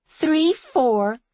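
put-on high, loud little-girl voice; dignified child's retort. A narrator's reading. Little girl retorting to the woman, dignified. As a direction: count back defiantly like a small child. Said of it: "she retorted with dignity".

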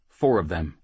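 plain reading voice

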